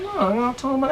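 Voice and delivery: usual speaking voice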